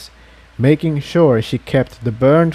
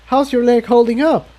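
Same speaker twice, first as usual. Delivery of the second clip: put-on higher, anxious young mare voice; worried Tiptoe; 10 semitones higher than usual. A narrator's reading. Tiptoe worrying Jigsaw will burn out, high anxious voice. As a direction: ask with worried concern, voice raised high.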